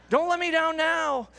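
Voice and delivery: put-on high child's plea; little boy voice. Father repeating his son's plea to Google in a high child voice. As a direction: beg it like a small boy. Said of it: "in a little seven-year-old voice".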